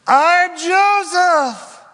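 very high, loud, slowly stretched-out reveal; overwhelmed cry. Acted. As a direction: cry out the truth at last, overwhelmed. Joseph reveals himself to brothers.

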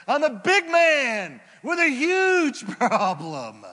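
loud, high, slow mock rage; furious. Naaman fuming at the prophet, slow loud mock rage. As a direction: play the offended big man, outraged.

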